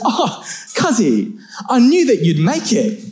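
high, drawn-out cuzzy greeting; mock relieved praise. Cousin who ran off greets the one left behind, high mock assurance. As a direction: gush in a high pitch, excusing yourself.

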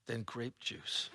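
dry anticlimax, words drawn out and dropped; deadpan letdown. Shown as a demonstration. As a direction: let the punchline land flat and quiet. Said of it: "Doesn't have the same poetic flow".

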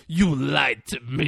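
slow, drawn-out mock accusation; put-on lingering reproach; Denzel impression. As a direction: draw the words out as an imitated gotcha. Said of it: "Like, just like that".

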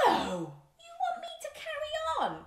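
high-pitched comic protest; dismayed disbelief. Acted. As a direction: whine in mock horror at the listeners. Dismayed listeners want more.

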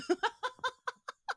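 high burst of giggles; laughing. Real, not acted. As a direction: crack up at your own joke. Cracks up at own remark.

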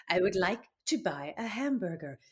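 hammed-up comic French accent; a mock French accent from the film scene; Steve Martin's French hamburger line. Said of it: "Steve Martin in The Pink Panther".

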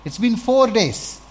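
loud and high objection; protesting Martha. Acted. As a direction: Martha protests, half laughing, pleading with the Lord. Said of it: "Then Martha said".

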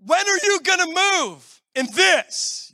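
lightly voiced frustrated prayer; exasperated prayer. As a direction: complain to God, worn out from waiting. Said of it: "where I'm like".

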